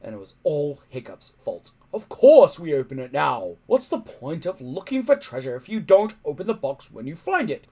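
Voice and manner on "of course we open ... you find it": loud impatient roar; chief shouting. Acted. Chief Stoic shouting down his son's worry, loud.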